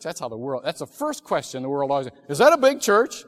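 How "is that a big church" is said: mocking caller. Mimicking the world's first question.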